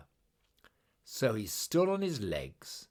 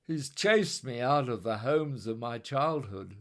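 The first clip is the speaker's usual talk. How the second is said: tired complaint; 4 semitones higher, 4 dB louder than usual. Acted.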